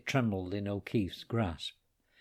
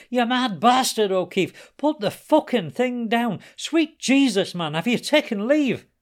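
high, loud panicked scream; 13 semitones higher, 9 dB louder than usual. Acted. Timothy screaming at O'Keefe to drop the gun, panicked. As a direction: yell in terror at the gunman.

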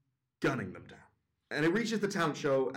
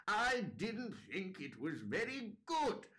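put-on slow, high, soft old-woman verdict; lightly spoken but damning, drawn out with big swoops; harsh old judge; 8 semitones higher, 6 dB quieter, slower than usual. Old communist judging the dads' song, slow and cutting. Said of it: "she just brings the hammer down".

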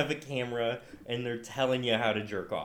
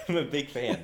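laughing; 3 dB quieter than usual. Real, not acted. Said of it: "I need to compose myself".

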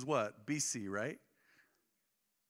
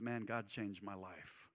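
simple sincere testimony; 6 semitones lower, 8 dB quieter, slower than usual. Shown as a demonstration. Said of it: "Sharing your faith is as simple as".

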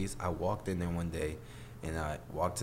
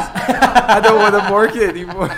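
loud, high, hammed-up begging; mock pleading; 14 semitones higher, 17 dB louder than usual. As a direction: plead in fake despair through laughter. Mock begging to quit the job.

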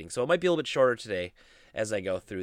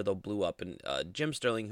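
ordinary delivery

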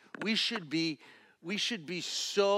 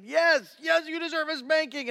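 loud, high, exasperated agreement; frustrated dad; 12 semitones higher, 9 dB louder than usual. Retold or imitated. Father telling his son he earned a spanking. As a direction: let the frustration boil over. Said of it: "I said".

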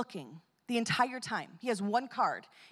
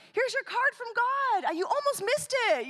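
put-on little boy voice; 13 semitones higher, 3 dB louder than usual. The boy greets the tattooed man in a high eager child voice. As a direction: play the excited kid who finally found him. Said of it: "he's like".

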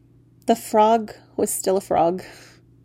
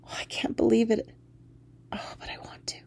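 whispered, much softer; awed whisper; 9 dB quieter than usual. Acted. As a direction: breathe it in disbelief. Overwhelmed at seeing Jack.

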